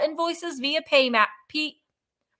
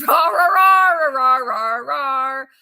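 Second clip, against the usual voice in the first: playful rar shout; 6 dB louder, much slower than usual. Pretend. Echoing the rar chant.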